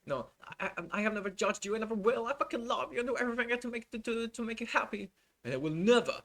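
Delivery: high, breathless, gushing devotion, racing in places; gushing assistant impression. Assistant gushing to Depp, high-pitched and adoring. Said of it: "this is the exact tone he is probably using here".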